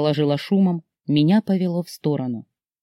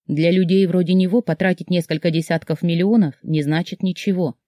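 ordinary delivery, nothing marked

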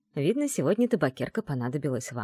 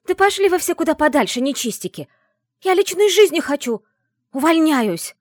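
loud, high, furious outburst; angry yelling; 10 semitones higher, 9 dB louder than usual. Acted. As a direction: yell it out as you storm off. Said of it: "Привлёк меня крик какой-то девушки".

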